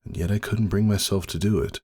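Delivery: usual speaking voice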